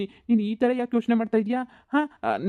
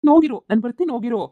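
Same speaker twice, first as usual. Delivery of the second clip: hurried urging; 3 semitones higher, 5 dB louder than usual. Acted. Hurrying fiancée away.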